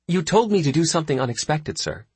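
sweetly innocent. Acted. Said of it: "Captain Mason replied sweetly".

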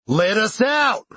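high, drawn-out yell from behind a door; demanding shout. A narrator's reading. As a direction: stretch out a desperate demand. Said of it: "as if they were all speaking".

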